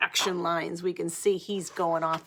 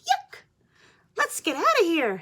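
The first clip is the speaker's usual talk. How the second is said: very high, louder squeaky fly yelling yuck; a tiny fly grossed out, squeal it up high; disgusted fly; 11 semitones higher, 5 dB louder than usual. Acted. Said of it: "says this one".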